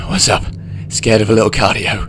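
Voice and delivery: cocky ribbing of a winded friend; teasing jab. A narrator's reading. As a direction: needle him for being out of breath. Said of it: "getting a jab in".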